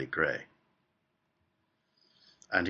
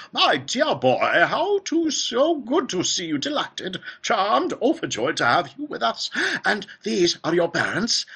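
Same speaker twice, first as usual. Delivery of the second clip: high, fluty, effusive welcome; Willy Wonka; 13 semitones higher, 6 dB louder than usual. A narrator's reading. Factory owner pumping the boy's hand, high and overjoyed. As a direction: gush loudly in a soaring piping voice. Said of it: "cried Mister Wonka".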